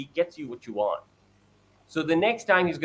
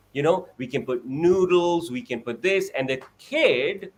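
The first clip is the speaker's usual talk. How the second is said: ordinary talk, nothing marked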